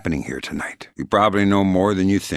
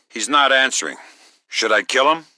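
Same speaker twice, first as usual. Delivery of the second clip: tense raised voice; 8 semitones higher than usual. Acted. Interrogation, threatening captive.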